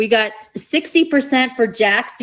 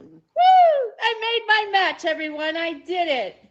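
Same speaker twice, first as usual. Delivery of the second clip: excited; 8 semitones higher than usual. Real, not acted. Host celebrates making her match.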